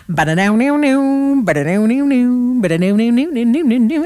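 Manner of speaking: plucky banjo riff done with the mouth; banjo impression. Shown as a demonstration. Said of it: "my best impersonation of a banjo".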